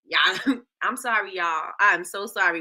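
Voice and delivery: usual speaking voice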